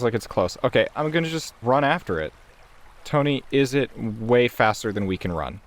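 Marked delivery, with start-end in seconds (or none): none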